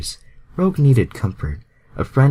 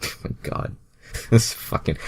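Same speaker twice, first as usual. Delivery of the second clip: breathy laugh through the words; cracking up at the text; real giggling disbelief; 3 semitones higher, 6 dB quieter than usual. Real, not acted. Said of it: "reader laughs at the fanfic".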